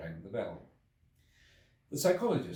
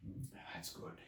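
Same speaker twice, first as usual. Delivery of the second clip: breathless whispered relief; 12 dB quieter, slower than usual. A narrator's reading. Exhausted traveller savouring the champagne, whispered. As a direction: sigh it out after the drink.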